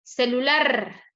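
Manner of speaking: "Celular" slowly, LAR pushed and held; final-syllable stress. Shown as a demonstration. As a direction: lean long on the end of "Celular". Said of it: "tiene la mayor fuerza de voz".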